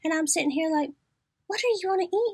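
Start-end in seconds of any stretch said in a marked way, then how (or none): 1.5-2.3 put-on coaxing like a kid. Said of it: "do her like the kids".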